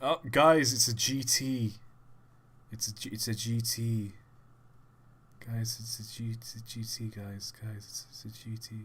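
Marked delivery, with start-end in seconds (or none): 2.7-8.8 softer with each repeat; repeating the pun ever softer; trailing off. Real, not acted. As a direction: fade the joke out, much softer.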